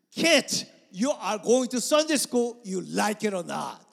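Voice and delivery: put-on loud, high, firm order to the kids; stern parent command. Parent telling kids they are going to Sunday school, stern command. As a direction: lay down the law loudly, no argument.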